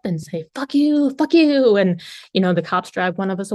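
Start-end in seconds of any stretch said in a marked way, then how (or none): 0.6-1.8 mock yell. Mock blowup ending relationships.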